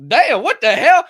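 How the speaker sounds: high, loud "damn, what the hell"; shocked laughing outburst. Retold or imitated. He reacts in delighted shock to her cussing. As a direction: recoil in mock shock. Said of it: "I was like".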